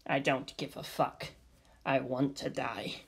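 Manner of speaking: worn-out partner muttering in the dark tunnels; low, defeated mumble. Acted. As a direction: let it sink with no energy. Despairing partner in the lair.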